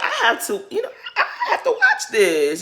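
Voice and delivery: high, laughing rush of excitement; excited gushing. Retold or imitated. Telling viewers how hyped she was, laughing high. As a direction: bubble over, laughing at the thought. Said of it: "And I was just like".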